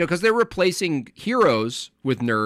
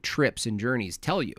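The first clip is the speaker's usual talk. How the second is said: ordinary delivery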